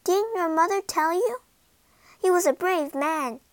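high, light fairy voice. A narrator's reading. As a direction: speak as a small kindly fairy.

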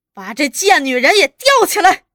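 loud, high, vicious order; shouted command. A narrator's reading. Interrogator ordering woman hung up, shouted. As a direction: bellow the order to your men. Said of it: "又下命令".

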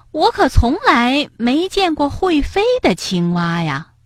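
high-pitched, mildly sceptical remark; slightly doubtful. A narrator's reading. Rabbit questions storks about flying frogs, slightly doubtful. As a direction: voice some doubt, pitched high then dropping away. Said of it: "蹦蹦有点怀疑".

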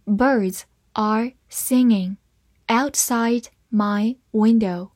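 slow, word-by-word model reading; unhurried read-along for learners. Shown as a demonstration. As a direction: pause briefly between the words. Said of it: "慢慢来读".

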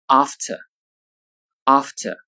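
"after" with a long back "ah" and no final r, British style; British pronunciation. Shown as a demonstration. Teacher giving the British "after" before the American. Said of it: "英式英语中发作".

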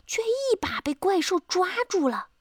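usual speaking voice

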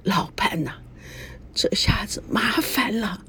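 softly, half whispered to her husband; quiet voice. Acted. Grandma whispers her fear to her husband, quietly. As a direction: hush the words, scared they'll be heard. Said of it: "小声地说".

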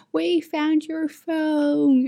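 put-on very high, slow little-child voice; sing-song like a proud toddler; cute kid voice. Little kids telling her they found her phone, tiny high voice.

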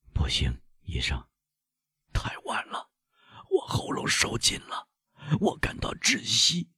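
breathless, voiceless whisper of a choking man; dying whisper. Acted. Poisoned servant to the doctor, a choking whisper. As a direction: gasp it out with no voice left.

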